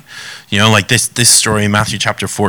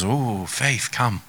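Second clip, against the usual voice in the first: soft gentle call; 10 dB quieter, much slower than usual. Acted. Jesus gently calls Peter.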